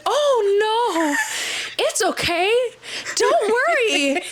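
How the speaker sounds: very high, slow sugary voice with a laugh; fake-sweet. Friend mimicking her sugary fake politeness to the men. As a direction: sing it out falsely sweet. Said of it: "And you said".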